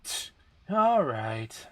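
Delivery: slow, grudging "Tch, all right"; reluctant. Acted. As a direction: drag out the "all right" unwillingly. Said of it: "she sounded reluctant".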